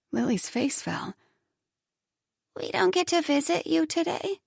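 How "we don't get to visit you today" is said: high, softer, let-down voice; crestfallen little girl. Acted. Little girl disappointed by mother, high hurt voice. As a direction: ask it like a hurt child.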